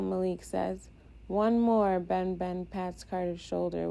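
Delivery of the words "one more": loud upbeat cheer for the team; rallying call. Acted. Catcher rallies the pitcher for the last out, loud cheer.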